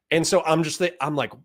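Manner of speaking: ordinary delivery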